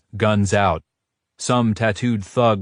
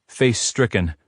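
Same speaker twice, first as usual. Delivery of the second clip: plain reading voice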